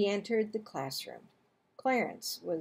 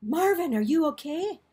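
worried question; 6 semitones higher, 4 dB louder than usual. A narrator's reading. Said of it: "asked Missus North".

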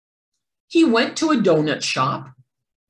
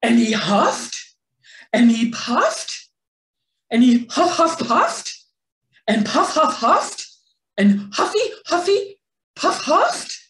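rising ever higher and louder; wolf straining himself to collapse, climbing in pitch; frantic huffing; 6 semitones higher, 5 dB louder than usual. A narrator's reading. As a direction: huff and puff faster and more desperate. Wolf huffs desperately, exhausted.

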